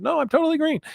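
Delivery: hammed-up high squeak; mock insistence. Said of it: "I said".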